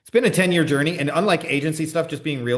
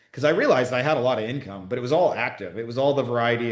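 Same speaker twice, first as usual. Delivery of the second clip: ordinary talk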